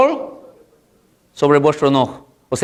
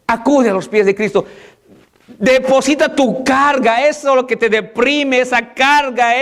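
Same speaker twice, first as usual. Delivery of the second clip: high, slow, impassioned plea to come forward; fervent preaching; 8 semitones higher than usual. Acted. Pastor calling the congregation to lay down their burdens, fervent and high. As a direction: lift the voice and draw each phrase out.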